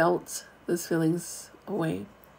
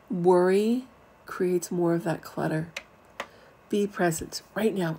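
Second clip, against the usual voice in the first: ordinary delivery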